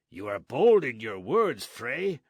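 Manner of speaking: loud warning to the friar; threatening sergeant. Acted.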